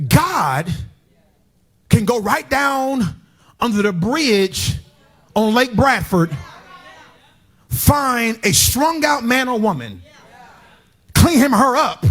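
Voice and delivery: high, slow preacher's whoop; fiery sermon climax; preaching at full force. Acted. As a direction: drag the words out and call them home.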